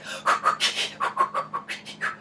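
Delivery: quick, breathy panic puffs; imitated labour breathing, exaggerated; birthing breathing. Driver calming a panic attack, imitated birthing breathing. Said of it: "like, birthing breathing where I was like".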